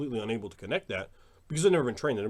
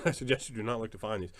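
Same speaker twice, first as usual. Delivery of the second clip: ordinary talk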